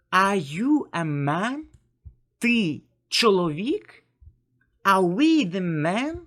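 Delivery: slow model questions, "man", "чоловік" and "men" each turned into a query; questioning tone. Shown as a demonstration. Teacher demonstrating questions to language learners, questioning tone. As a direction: ask "Are you a man?" slowly and clearly, voice lifted. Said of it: "you just make a questioning tone".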